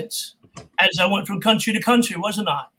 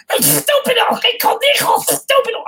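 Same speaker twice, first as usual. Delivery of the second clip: much higher, spluttering cartoon duck in Spanish; Spanish Daffy Duck; 4 dB louder than usual. Shown as a demonstration. Voice actor demoing Daffy Duck dubbed in Spanish, high splutter. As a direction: spit it out like an outraged Daffy. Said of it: "and Daffy Duck".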